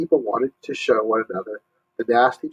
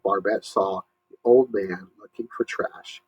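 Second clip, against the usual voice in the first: plain reading voice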